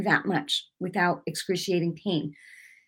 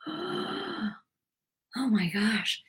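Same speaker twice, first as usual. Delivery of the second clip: awed gasp; 5 semitones higher, 8 dB quieter, much slower than usual. Retold or imitated.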